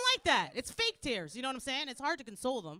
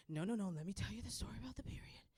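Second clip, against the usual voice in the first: fast hushed storyteller whisper; 4 semitones lower, 7 dB quieter, faster than usual. Retold or imitated. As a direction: whisper it like a bedtime secret. Bedtime story voice.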